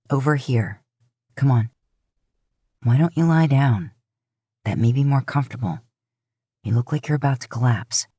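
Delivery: low, soothing, taking charge of the hurt; older brother coaxing the injured moose to lie down; Dean, reassuring. Acted.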